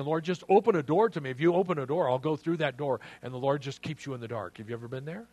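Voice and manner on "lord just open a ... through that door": loud, raised-pitch plea to God; acted insistent prayer; urgent pleading. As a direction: bargain with God, pushing hard. Acting out a bargaining prayer.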